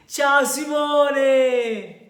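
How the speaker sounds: long, high, loud call across the room; drawn-out cheerful hello shout; calling out a greeting. Real, not acted. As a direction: sing out the name to a friend arriving. Greeting a friend joining the call.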